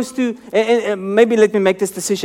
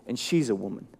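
dismissive aside; 10 semitones lower, 13 dB quieter than usual. Retold or imitated. Voicing the disciples' pride.

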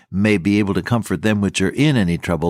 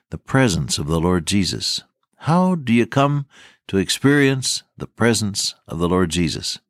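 usual speaking voice

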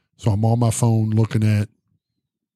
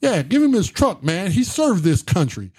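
high-pitched hype-man cheer; 6 semitones higher than usual. Retold or imitated. Bum vouching for the veteran to the police, hype man. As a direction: back him up like a keen sidekick. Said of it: "[name] back there fucking".

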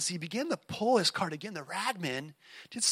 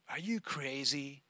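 slow, softer scornful sneer; 3 semitones lower, 7 dB quieter, slower than usual. Acted. One-armed man mocking the ragman, slow sneer. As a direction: scoff at the ragman slowly. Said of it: "sneered the other".

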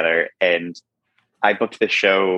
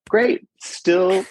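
sarcastic; 9 semitones higher than usual. Retold or imitated. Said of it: "And I was like".

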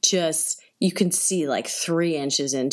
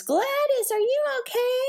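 high, frantic calling for a loved one; calling out in panic. Retold or imitated. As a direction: mimic a panicked passenger calling a name, much higher. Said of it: "people calling out to their loved ones".

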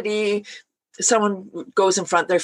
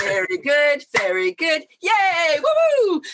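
cheerful cheer; 8 semitones higher, slower than usual. Shown as a demonstration. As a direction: cheer the group on, climbing up. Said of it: "so we say".

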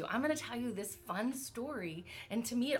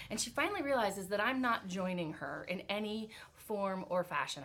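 ordinary delivery

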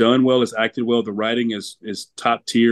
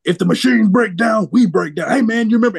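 higher, louder put-on voice, mock-earnest; Kirk Lazarus impression; 9 semitones higher, 5 dB louder than usual. Host quoting Kirk Lazarus to cohosts, in a higher, louder put-on voice. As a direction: channel the deluded method actor, pitched up. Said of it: "and just Kirk is just like".

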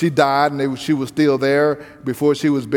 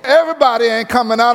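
ordinary talk

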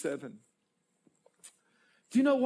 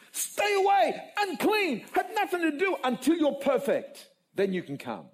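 harsh rejection; 8 semitones higher than usual. Retold or imitated. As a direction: push the sinner away like a strict religious judge. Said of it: "their God concept was".

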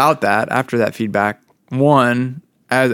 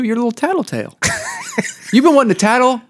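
teasing; 7 semitones higher, faster than usual. Pretend. Teasing cohost as tattletale.

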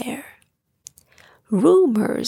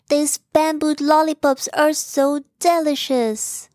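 higher, happy character voice; delighted exclaim; 6 semitones higher than usual. Acted. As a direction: savour it with delight. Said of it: "Bo exclaimed".

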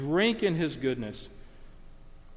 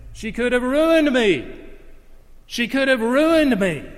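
much higher and louder, ringing preacher cry; E B Hill's delivery; 9 semitones higher, 7 dB louder than usual. Retold or imitated. Preacher imitating the pastor at his wife's funeral: loud, high, ringing. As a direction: belt it out like an old-time preacher at full force. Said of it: "as only E B Hill could say it".

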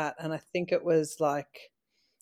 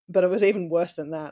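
ordinary talk

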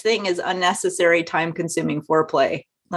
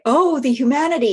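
mock anguished cry; 7 semitones higher than usual. Reading out the forced cry during bad oral sex, mock anguished cry. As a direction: cry out in hammed-up despair. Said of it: "you're forced to, to cry".

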